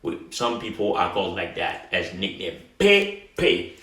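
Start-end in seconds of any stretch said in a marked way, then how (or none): 2.8-3.8 "P P" as pay-pay, closed vowels; unaccented vowel. Shown as a demonstration. Teacher repeats the nickname without the accent, "P P" as pay-pay. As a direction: pronounce "P P" with tight "ay" in both syllables. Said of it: "without the accent".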